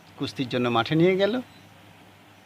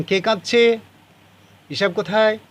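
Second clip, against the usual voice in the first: higher-pitched, half-raised calling out; mild mock yell; 6 semitones higher, 3 dB louder than usual. Ishab's father demands who is crying, mildly shouted. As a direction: call out the questions, lightly acting a shout. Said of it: "তিনি চেঁচিয়ে বলেন".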